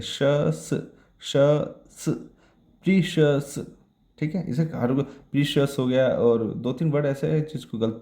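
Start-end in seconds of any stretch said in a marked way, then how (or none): 0.0-2.1 "श" then "स", each sound on its own with pauses; sounds shown separately. Shown as a demonstration. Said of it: "प्रीशियस पढ़ते हैं". 2.8-3.5 "प्रीशियस" higher, demonstrated as pree-shus; correct pronunciation. Shown as a demonstration. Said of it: "प्रीशियस पढ़ते हैं".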